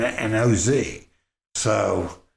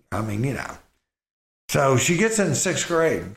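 ordinary talk, nothing marked

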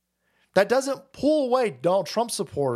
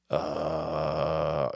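much lower, dopey put-on voice; mock bewildered voter; 4 dB quieter, much slower than usual. Mocking lefty voters who realise they were fooled, low dopey voice.

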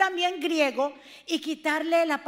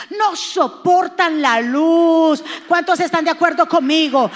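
slow, loud, hammered-out words; shouted preaching; 9 dB louder, slower than usual. Acted. Pastor shouting to the congregation about the light. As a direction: shout it to the rafters.

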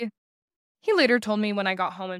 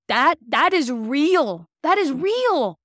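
louder, slower, climbing much higher on the second; fervent realization; 7 semitones higher, 8 dB louder, much slower than usual. Retold or imitated. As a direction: declare it like a revelation. Sudden realization of scripture's truth.

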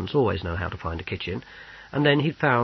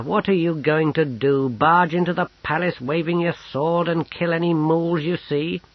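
higher, louder, incredulous run; scornful protest; 7 semitones higher, 4 dB louder than usual. A narrator's reading. Companion scoffing at the plan to storm the palace, incredulous. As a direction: pour scorn on a reckless plan.

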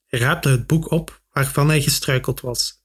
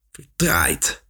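low, soft sigh of a curse; 9 semitones lower, 4 dB quieter than usual. A narrator's reading. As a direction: let the word drop into a weary sigh. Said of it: "zuchtte hij tenslotte".

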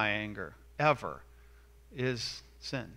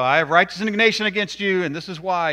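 louder, fast, pompous mock scolding; mock indignation; 3 semitones higher, 8 dB louder, faster than usual. Person at home scolding family, mock indignant. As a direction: play a spouse puffed up with self-righteousness. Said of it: "So don't go home and go".

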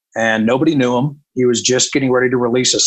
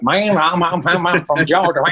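mock garbled Southern country accent, pitched up; thick Georgia drawl; 3 semitones higher than usual. Radio programmer mimicking a new country singer, garbled Georgia accent. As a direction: imitate an unintelligible Georgia farm-boy accent. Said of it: "a decoder ring to understand him".

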